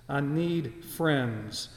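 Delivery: slow plea. Acted. Slow, softer repeat.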